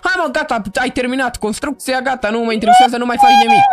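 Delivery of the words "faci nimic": bursting out in a loud high yell; sudden shout. Real, not acted. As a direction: yell it at him out of nowhere. Yelling at friend in game.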